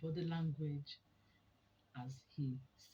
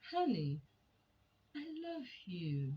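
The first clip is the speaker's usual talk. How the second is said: loving; 3 semitones higher than usual. Shown as a demonstration. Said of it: "sounded like love itself".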